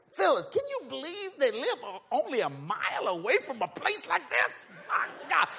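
high, excited imitation of an astonished friend; amazed exclamation. Friend telling Phyllis about the place a mile away, high amazement. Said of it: "he says this".

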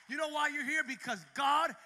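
loud, high, shouted preaching; full-force preaching. Acted. Preacher builds to shouting climax.

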